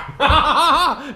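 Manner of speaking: high loud laugh; burst of laughter. Real, not acted. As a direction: crack up out loud. Laughing at child's tantrum.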